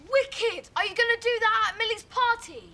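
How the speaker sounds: high, eager burst; excited. Acted. Child to parent about the balloon trick, thrilled. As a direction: marvel at it with delight.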